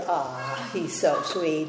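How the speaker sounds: put-on soft, low, tender cooing over a baby; tender mother. Mother cooing softly over her newborn baby, tender. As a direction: coo over the newborn gently.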